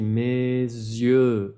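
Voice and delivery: Mes yeux with the Z clearly voiced into yeux; French liaison. Shown as a demonstration. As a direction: lean on the Z between mes and yeux. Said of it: "Y que sea bien la Z".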